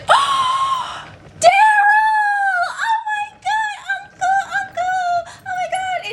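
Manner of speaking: very high, drawn-out shriek of delighted greeting; high-pitched gushing reunion cry; thrilled squeal. Retold or imitated. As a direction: imitate mom squealing at a surprise relative. Mom greets long-lost uncle, acted.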